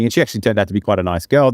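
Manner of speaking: ordinary talk, nothing marked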